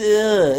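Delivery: short annoyed "Ugh" groan; exasperated little groan. Retold or imitated. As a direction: groan like the voice grates on you. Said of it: "it's just".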